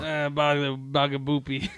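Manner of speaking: hammed-up Italian-American mobster accent; badly faked mafia-boss accent, mock heartbroken; terrible mobster accent. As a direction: play a wounded don in a bad Italian-American accent. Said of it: "that was a terrible accent".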